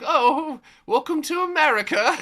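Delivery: very high, loud mock greeting. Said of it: "we'll dead ass mock them right in their face".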